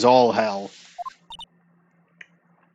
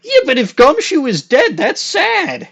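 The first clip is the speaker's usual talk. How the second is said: 1 mock lament; 12 semitones higher, 4 dB louder than usual. Real, not acted. Mock grief over Gumshoe.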